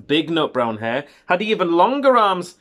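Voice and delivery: unhurried picture-book narration with lifted key words; children's story reading. A narrator's reading. Parent reading a bedtime hare story to a child, storytelling. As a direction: read it to a little child, savouring the phrases.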